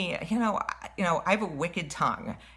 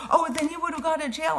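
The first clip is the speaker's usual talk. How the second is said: high, fast mocking squeal of the rude woman; 11 semitones higher, faster than usual. Quoting the woman who cut in line, in a high mocking squeal. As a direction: mimic her as a shrill squeal.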